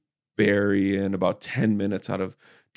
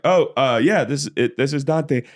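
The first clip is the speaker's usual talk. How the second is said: mock formal voice; 8 semitones higher than usual. Retold self as character.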